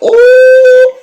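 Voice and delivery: very high, loud drawn-out ooh; shocked squeal. Real, not acted. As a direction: shriek at the sass. Reacting to smart-mouthed guy.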